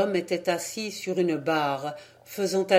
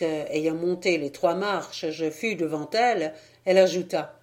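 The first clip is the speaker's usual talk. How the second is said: plain reading voice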